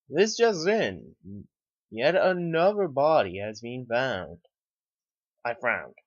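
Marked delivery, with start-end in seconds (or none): 0.1-4.4 slow, loud news anchor announcement. Acted. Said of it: "listening to the news anchor with breaking news".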